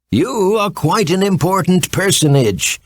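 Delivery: a lightly voiced, grand fox king. A narrator's reading. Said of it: "said the Fox King".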